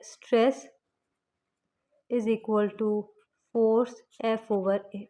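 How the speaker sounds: slow, word by word, formula read out for notes; slow dictation. Shown as a demonstration. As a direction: dictate it slowly so the class can write. (धीरे-धीरे लिखवाते हुए)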